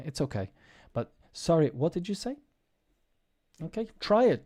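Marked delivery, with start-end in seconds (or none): 1.3-2.4 high "Sorry", rising on "say"; polite high questioning lilt ending up on "say"; polite intonation. Shown as a demonstration. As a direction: ask it nicely, pitched up, lifting "say". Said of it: "all you have to do is sound polite".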